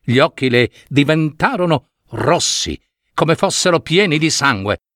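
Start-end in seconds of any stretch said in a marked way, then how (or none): none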